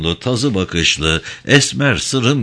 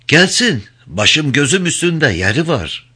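higher, hospitable voice; young host welcoming the old woman; welcoming young man; 6 semitones higher than usual. Acted. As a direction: welcome her gladly. Cano welcomes the guest.

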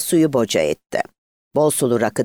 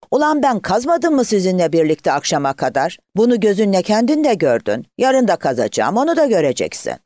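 high-pitched, mildly angry protest; narrated quarrel, insistent and indignant; indignant retort; 8 semitones higher, 3 dB louder than usual. A narrator's reading. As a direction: argue back hotly, voice raised in pitch. Acted quarrel, indignant retort to accuser.

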